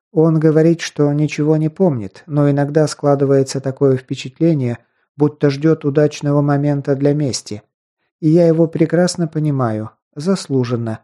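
plain reading voice

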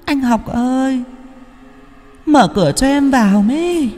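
ghost calling. A narrator's reading. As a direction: call out long and eerie like a wandering ghost. Ghost calls from outside door. (ma nữ gọi cửa, giọng cao kéo dài rợn người)

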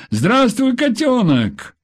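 bright high call of a loving husband; husband's cheerful greeting. A narrator's reading. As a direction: greet her tenderly, lifting the voice. Husband voiced on phone. (ласковое приветствие мужа)

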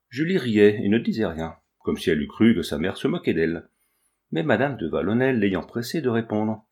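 ordinary delivery

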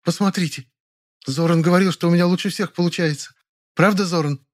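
high coquettish voice. A narrator's reading. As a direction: preen and flirt. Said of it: "томно-кокетливой".

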